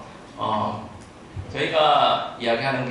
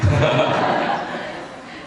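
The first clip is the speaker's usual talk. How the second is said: short breathy laugh; amused laugh; 3 semitones higher, 4 dB louder than usual. Real, not acted. Laughs at introduction.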